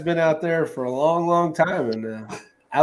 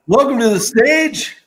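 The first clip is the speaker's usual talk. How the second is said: club emcee voice; 7 semitones higher, 4 dB louder than usual. Pretend. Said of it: "Come on in to Showgirl".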